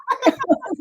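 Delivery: a real laugh, pitched very high; a moved, high laugh; high emotional laugh. Real, not acted. Moved laugh after listener's praise.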